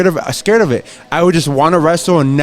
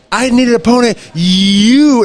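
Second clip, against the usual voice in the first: higher, louder ring callout; heel promo callout; 6 semitones higher, 3 dB louder, slower than usual. Retold or imitated. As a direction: call it out across the arena. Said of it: "I was like".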